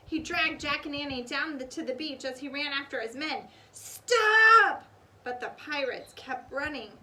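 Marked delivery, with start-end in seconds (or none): 3.7-4.8 shout. Acted. Captain chasing his men.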